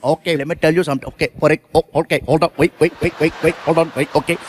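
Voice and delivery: put-on flustered Indian accent. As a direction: trip over yourself with an Indian accent. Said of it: "you can see it".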